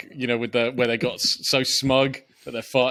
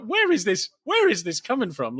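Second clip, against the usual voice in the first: higher, baffled disbelief; incredulous; 9 semitones higher than usual. Retold or imitated. Critic mocks his own disbelief at the kiss scene, incredulous. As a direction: ask it in disbelief. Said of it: "I'm like".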